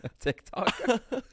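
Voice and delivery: high, slow Australian drawl; Australian accent. Retold or imitated. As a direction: stretch it out like an Aussie star. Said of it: "In her Australian accent".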